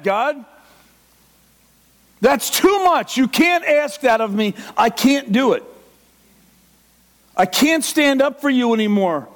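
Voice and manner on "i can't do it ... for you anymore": ordinary talk, nothing marked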